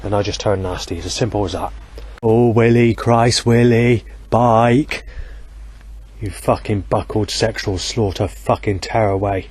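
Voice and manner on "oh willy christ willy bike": loud, sudden exclamations; exasperated outcry. Real, not acted. Man on a night walk shouting at a passing bike, exasperated. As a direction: blurt each word out in exasperation.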